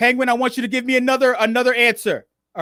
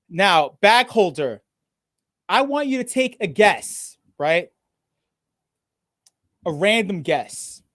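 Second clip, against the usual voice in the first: deliberately slow; 3 semitones lower than usual. Real, not acted. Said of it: "obviously I'm speaking very slowly right now".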